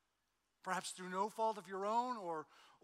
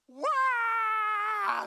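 mock toddler wail, much higher and louder; child's loud wail; 14 dB louder than usual. Dad imitating Martin bursting into tears again, loud wail. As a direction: let out a sudden bawling "Wah!".

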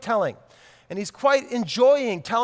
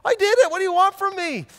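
put-on much higher and louder, a little boy cracking; broken confession; 10 semitones higher, 6 dB louder than usual. Little son cracking under dad's interrogation, high and loud. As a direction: blurt it out like a kid finally breaking. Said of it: "I break him to the point where he says".

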